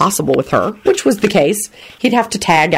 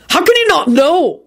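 mild shout, pitched high; 12 semitones higher, 3 dB louder than usual. A narrator's reading. Father challenging his daughter over her debts, shouted. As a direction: shout it in disbelief, pitch jumping up. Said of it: "he shouted".

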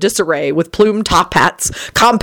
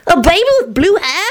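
put-on puzzled young boy; 10 semitones higher than usual. A narrator's reading. Teddy questions further.

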